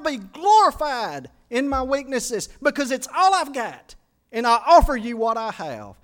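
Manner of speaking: high, loud, surging prayer at full force; impassioned preaching. Retold or imitated. Preacher reliving his surrender prayer to the Lord, high and loud. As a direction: pour it all out to God, climbing.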